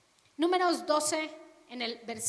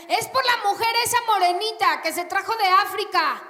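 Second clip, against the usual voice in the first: louder, pointed blame; loud accusing gossip; 7 semitones higher, 10 dB louder than usual. Acted. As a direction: blame the foreign wife loudly. Gossip blaming wife.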